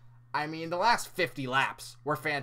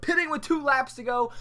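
exasperated; 10 semitones higher, 5 dB louder than usual. Real, not acted. Ranting about pit call.

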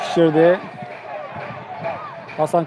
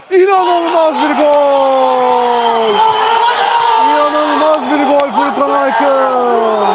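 high, slow stretched goal scream; screaming football commentator; 5 semitones higher, much slower than usual. Acted. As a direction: howl the goal like a TV caller. (çığlık atan maç spikeri)

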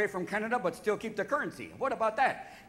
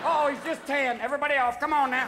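loud, raised-pitch orders; calling off the alarm; 7 semitones higher, 5 dB louder than usual. Acted. Security telling the team to get off a tanned man, loud calling. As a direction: shout everyone back down.

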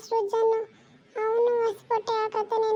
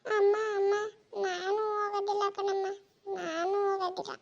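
softer, sobbing plea; crying child; 4 dB quieter than usual. Acted. Boy crying to his mother, begging for new clothes, softly sobbing. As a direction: whimper and beg through tears. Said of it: "ಅಳ್ಬೇಡಿ ಇಬ್ರುವೆ".

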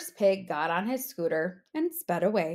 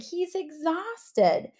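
first pig; 7 semitones higher than usual. Acted. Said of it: "said the first pig".

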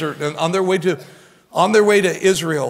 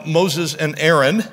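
normal talking voice

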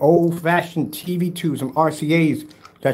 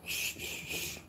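put-on soft breathy shushing like settling a baby; mock shushing; 20 dB quieter, much slower than usual. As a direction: hush the crying infant, teasing. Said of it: "I'm gonna put you over my shoulder".

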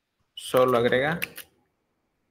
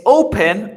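"open" stressed on the O, high and loud; stress on first syllable; 7 semitones higher, 10 dB louder than usual. Shown as a demonstration. Teacher quizzing students on "open", first syllable stressed. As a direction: punch the start of "open", let the end fall. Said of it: "La fuerza de voz está al inicio".

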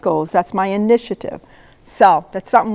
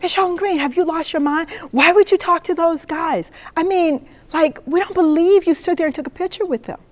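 high-pitched mock indignation; retold outrage, voice pitched up; mad students; 8 semitones higher than usual. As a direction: imitate students scolding in disbelief. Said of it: "They are mad with me".

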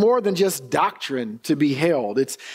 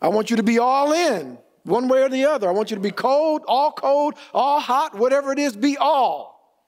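rising preached quote, higher; God's demand preached up high; preaching at full force; 6 semitones higher than usual. Acted. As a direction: build the preacher's call higher.

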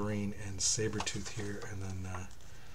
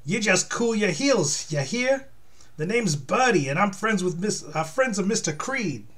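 high, loud, drawling warning; Birdie, sassy henchwoman; 9 semitones higher, 5 dB louder than usual. Acted. Henchwoman warning hero off, high and sassy. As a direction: put her voice way up, full of sass. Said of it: "I gotta do her different than that".

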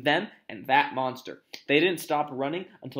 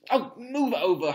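higher, exasperated order; snarled; 7 semitones higher, 5 dB louder than usual. Acted. Hermione pushing past Ron to the lock, snarling. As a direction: snarl it, drawing the words out. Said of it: "Hermione snarled".

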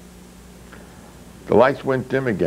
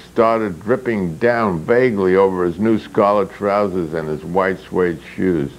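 plain reading voice

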